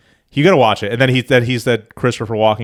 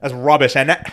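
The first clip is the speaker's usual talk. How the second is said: mock British remark; jokey British put-down; British accent; 2 semitones higher than usual. Shown as a demonstration. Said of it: "Can do British".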